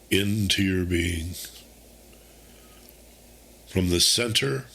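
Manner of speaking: calm, unhurried meditation voice with long pauses; guided meditation. Acted. Host guiding listeners through a quarantine meditation, calm and unhurried. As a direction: guide them gently, phrase by phrase, leaving space to breathe.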